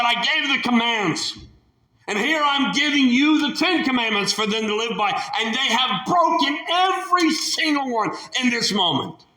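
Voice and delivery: high, exasperated complaint; God betrayed by broken laws; indignant God. Acted. God telling Moses the commandments are broken, indignant. God voiced, grieved and angry.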